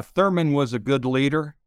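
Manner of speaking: ordinary talk, nothing marked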